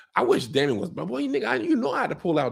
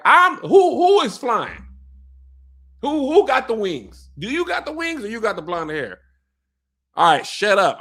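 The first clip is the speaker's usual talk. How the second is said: raised, louder and higher mock argument; mock yell; 9 semitones higher, 6 dB louder than usual. Dragon Caraxes shouting down Daemon in a mock argument. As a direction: loudly boss him about who has the wings. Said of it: "he was like".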